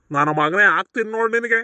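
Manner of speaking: loud cursing threat; heated, forceful scolding; angry threat. Acted. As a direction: threaten the thief with a thrashing. Curses and threatens the thief.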